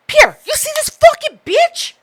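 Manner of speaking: high, scandalized call to a friend; retelling a scandalized call to Pierre; mock indignant. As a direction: act outraged, pulling Pierre into it. Retold complaint to friend Pierre.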